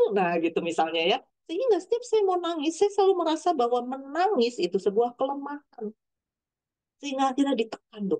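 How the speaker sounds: ordinary talk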